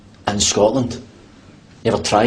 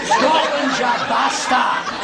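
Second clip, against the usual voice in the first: much higher laughing war cry; battle cry; 12 semitones higher, much slower than usual. Pretend. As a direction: roar Scotland like a rallying cry at the lift. Rallying cry at the lift.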